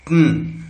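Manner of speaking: "टन" as t straight into n, no vowel; syllabic n. Shown as a demonstration. Teacher repeating the correct sound, syllabic consonant. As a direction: say "टन" as one vowelless "tn". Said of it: "ऐसा बोलना है".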